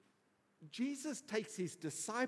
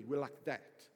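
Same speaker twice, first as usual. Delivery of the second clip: low, matter-of-fact assurance; confident pledge; 7 semitones lower than usual. Retold or imitated. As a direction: play a sure-of-himself best mate. Peter confidently pledges closeness to Jesus.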